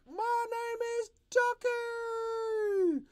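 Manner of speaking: high, slow drawn-out yell. Shown as a demonstration. Teacher demos a yelling comic character, slow high yell. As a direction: call the name out like a cartoon shout. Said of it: "if he's yelling it out, you can be like".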